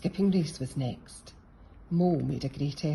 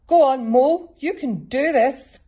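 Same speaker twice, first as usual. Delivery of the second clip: encouraging cheer; 9 semitones higher, 12 dB louder than usual. A narrator's reading. Rabbit cheering Mole to the finish, loud and encouraging. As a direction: urge him over the line. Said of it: "smiled Rabbit".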